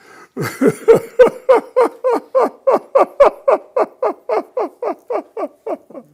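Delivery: big, high burst of laughter; loud laugh. Real, not acted. Laughing loudly at his father's speeding-ticket punchline.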